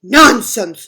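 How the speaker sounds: curt dismissal; dismissive. Acted. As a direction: brush it off firmly. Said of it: "said Moose".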